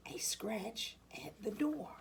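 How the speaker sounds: whispered, hushed suspense; dramatic whisper. A narrator's reading. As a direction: breathe it out like a secret. Suspense at the door.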